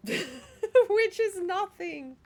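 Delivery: slow words through laughter; laughing. Real, not acted. Laughing at own joke.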